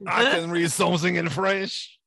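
loud, hammed-up French accent; badly faked French accent, played for laughs; terrible French accent. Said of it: "my terrible French accent".

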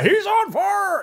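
high, slow announcer yell; announcer shout. Retold or imitated. NBA Jam announcer quote.

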